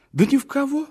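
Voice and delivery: высокий громкий возглас; выпалить с жаром; горячо. Acted. Мечтатель горячо восклицает. (громкое восклицание)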